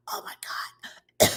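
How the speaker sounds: voiceless, strangled whisper, acted out; sudden voice loss. Reliving a salad bite at her friend's, voice suddenly gone. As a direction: imitate losing your voice mid-meal. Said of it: "I went from talking like this".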